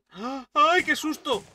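real jump-scare yelp; startled shriek. Real, not acted. Player scared by a sudden enemy, startled shriek. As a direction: gasp into a high, loud cry.

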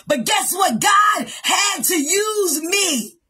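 shouted, rising sermon voice; fiery preacher driving the point home; preaching at full force. Acted. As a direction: preach it hard, building to a shout. Preacher voicing Jephthah's rebuke.

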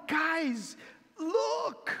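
high, rising eager call; excited call. Retold or imitated. Stephen telling everyone to look, high rising call. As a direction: grab their attention with joy. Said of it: "lets everybody know".

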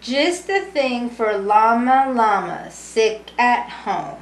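lively sing-song storybook reading; reading to children. A narrator's reading. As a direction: read it warmly to a room of kids. Reading picture book to children.

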